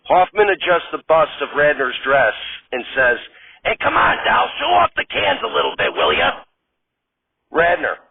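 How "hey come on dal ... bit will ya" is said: fussy director. Acted. Director pushes Radner.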